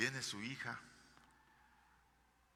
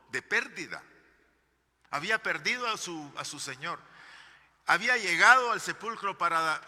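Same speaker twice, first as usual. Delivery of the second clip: loud, rising sermon delivery; fervent pulpit preaching; preaching at full force; 5 semitones higher, 9 dB louder than usual. Acted. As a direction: preach it out to the congregation, building.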